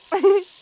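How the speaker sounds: short, loud giggle; a burst of cheeky laughter; playful laugh. Acted. Snake girl teasing her warm best friend, playful laugh.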